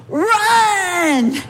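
sharp high shout of Run; shouted warning. Retold or imitated. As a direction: yell it to make her flee now. Mom shouting during riot.

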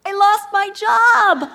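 high, loud, slow and beaming; excited news. Retold or imitated. Husband bursts in announcing lost job, excited. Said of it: "he's so excited".

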